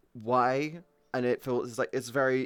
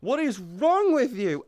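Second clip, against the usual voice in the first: high, loud, slow disbelieving rebuke; scolding mum over the dream grudge; exasperated scolding; 7 semitones higher, 5 dB louder than usual. Retold or imitated. High incredulous rebuke to mum. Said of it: "I'm like".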